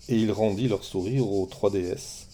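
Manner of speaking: usual speaking voice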